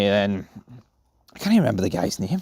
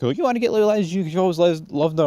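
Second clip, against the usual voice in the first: higher, hammed-up tearful whine; mock crying; 9 semitones higher than usual. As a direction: imitate him sobbing the accusation at his friend. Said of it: "And he's crying".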